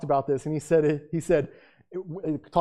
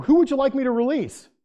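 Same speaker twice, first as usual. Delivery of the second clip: Pilate calling out; 9 semitones higher, 5 dB louder than usual. Acted.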